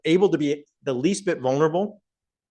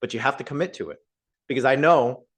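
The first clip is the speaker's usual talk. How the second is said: ordinary delivery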